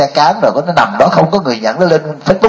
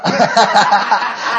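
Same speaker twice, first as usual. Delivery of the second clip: real laugh; 6 semitones higher than usual. Real, not acted. Laughs at childhood naivety.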